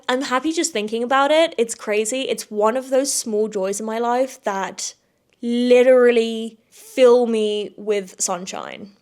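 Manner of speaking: usual speaking voice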